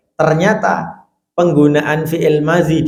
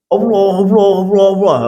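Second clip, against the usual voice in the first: loud, higher, insistent Allah Allah; wrong, pushy prompting; 6 semitones higher, 3 dB louder, faster than usual. Shown as a demonstration. Preacher showing how not to prompt a dying man, loud and pushy. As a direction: hammer the name at the dying man. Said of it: "aja ngono. Nggak boleh gitu".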